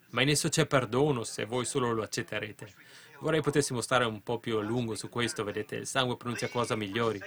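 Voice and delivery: normal talking voice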